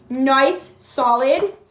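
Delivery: delighted shout of approval; loud excited nice. Real, not acted. Reader thrilled by the card, shouting it out loud. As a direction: punch it out, thrilled.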